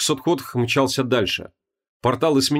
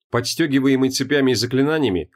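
usual speaking voice

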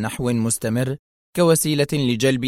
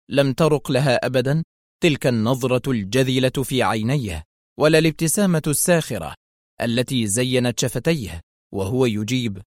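usual speaking voice

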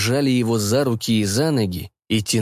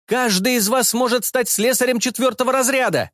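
panicky mechanic; 12 semitones higher than usual. A narrator's reading. Character voice of slesar.

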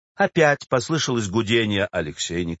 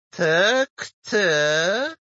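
put-on agreeing old woman; 5 semitones higher than usual. A narrator's reading. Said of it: "согласилась она".

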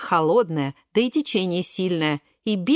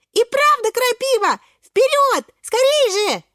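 very high, loud, excited cries; rally them in a squeaky shout; urgent urging; 6 dB louder than usual. Acted. Шпинатик urging friends through nettles, high excited shouting.

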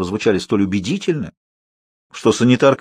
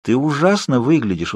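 normal talking voice

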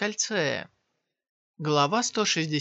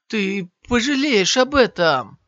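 louder, voice pushed through gritted teeth; 5 semitones higher, 7 dB louder than usual. Acted. Wounded warrior threatening Shiyan through gritted teeth. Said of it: "сказал сквозь зубы".

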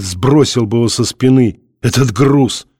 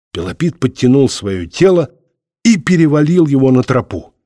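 plain reading voice

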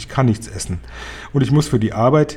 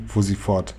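usual speaking voice

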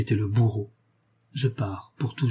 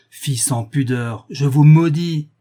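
slow, building angry denunciation; wrathful curse; 3 semitones higher, 7 dB louder, slower than usual. A narrator's reading. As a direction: drag out the curse, swelling to a loud cry. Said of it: "s'écria le père en courroux".

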